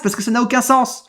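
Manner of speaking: ordinary talk, nothing marked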